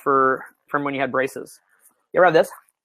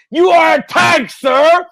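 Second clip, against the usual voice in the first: shouted higher and louder; loud, high, laughing praise; excited shout; 11 semitones higher, 7 dB louder, much slower than usual. Real, not acted. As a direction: yell it like hyping a soldier. Hyping up the audience.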